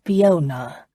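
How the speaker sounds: "Fiona" drawn out in three flowing syllables; caressed word. A narrator's reading. Bound man names his captor, caressing "Fiona" in three syllables. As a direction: say "Fiona" slowly, each syllable distinct and flowing. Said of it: "He caressed the word, giving it three distinct flowing syllables".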